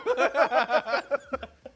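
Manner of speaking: high, loud giggling laugh; excited laughter. Real, not acted. Host after bragging about his game titles, high laughter. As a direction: crack up after a hype moment.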